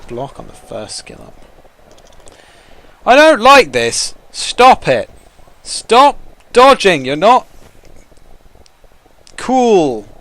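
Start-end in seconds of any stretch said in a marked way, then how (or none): 3.1-10.1 high, loud whiny protest; indignant yelling at the dice; loud complaint. Real, not acted. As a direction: shout it like you're being wronged. Yelling at opponent's dodges.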